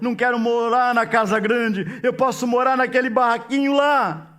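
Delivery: loud, high, fervent preaching; full-force preaching. Acted. Preacher proclaiming forgiveness to the church, loud and fervent.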